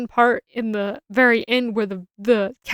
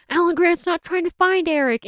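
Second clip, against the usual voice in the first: wife's voice; 7 semitones higher than usual. Retold or imitated. Wife voiced in retold movie scene.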